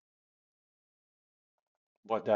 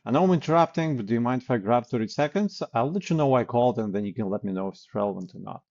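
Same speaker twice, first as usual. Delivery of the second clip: quick permission pitch; 4 dB louder, faster than usual. Shown as a demonstration. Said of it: "And you continue into the pitch".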